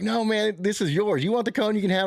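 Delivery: high good-natured insistence; high insistent refusal. Retold or imitated. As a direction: push the cone back politely but firmly. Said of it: "I was like".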